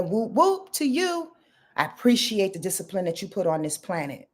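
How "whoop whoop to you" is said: high, drawn-out whoop, whoop; cheer. Acted. Shouting out the Capricorns with a cheer. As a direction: give them a little hype cheer.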